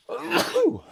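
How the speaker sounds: sudden loud sneeze; a real sneeze bursting out; sneezing. Real, not acted. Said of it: "I have to sneeze".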